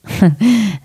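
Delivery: short real laugh at a misread word; the reader breaks off laughing at her own slip. Real, not acted. Reader to listeners, amused at her slip, laughing.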